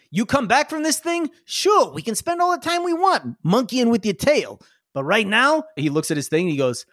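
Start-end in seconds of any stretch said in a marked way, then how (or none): 0.1-5.6 pitched-up, hurried mechanic; high urgent NPC. Acted. Mechanic rushing Zark toward the fuel run, pitched up. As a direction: push them to move. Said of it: "He's like".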